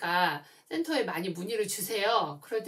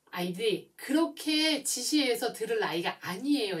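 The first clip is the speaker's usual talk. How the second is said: ordinary talk, nothing marked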